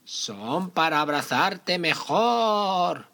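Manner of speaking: high, very slow granny voice; wolf faking grandma sweetly; fake grandma voice.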